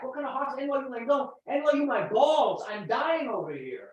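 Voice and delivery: loud, theatrical hospital outrage; hammed-up patient rant. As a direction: rail at the staff as if dying. Performing the hospital rant bit.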